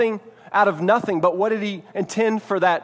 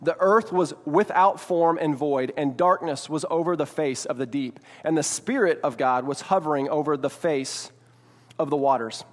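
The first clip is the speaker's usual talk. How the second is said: scripture reading; 4 semitones lower than usual. Acted. Reads Genesis aloud.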